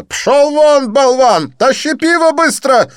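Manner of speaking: very high, loud furious yell. Acted. Didier yelling at the waiter, high furious yell. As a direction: explode at the waiter. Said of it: "Дидье взъярился".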